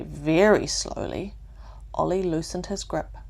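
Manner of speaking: ordinary delivery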